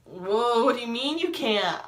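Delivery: drawn-out, rising disbelief; acted shock. Hearing the friend can't perform at Nationals, shocked disbelief. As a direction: re-enact being stunned, pitch climbing.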